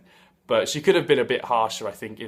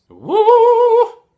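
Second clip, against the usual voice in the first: high, loud, sustained "woo"; long showy falsetto woo; 13 dB louder than usual. Retold or imitated. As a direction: let out a piercing falsetto whoop. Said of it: "just [name] sat there every now and then just going".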